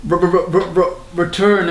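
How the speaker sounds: stuttered R-r-r into return of the in a British accent; lightly British stuttered intro. Shown as a demonstration. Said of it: "it's actually in a British accent".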